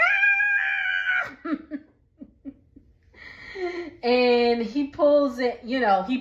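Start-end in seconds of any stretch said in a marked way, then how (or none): 0.1-1.4 very high, shrill imitated shriek; hammed-up high scream; mock vampire shriek. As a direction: shriek like a whole pack of vampires in pain. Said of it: "So they're all like".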